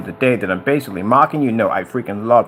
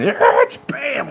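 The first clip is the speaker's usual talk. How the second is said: high, explosive comic crash; cartoonish outburst; crash sound effect; 11 semitones higher than usual. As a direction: act out the truck veering off wildly. Acting out truck careening off road.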